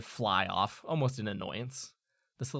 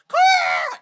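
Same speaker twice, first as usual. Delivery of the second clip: very high, loud caw; raven scream; 14 dB louder than usual. Acted. Raven over a squawking crowd, screamed caw. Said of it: "the screams of that one raven".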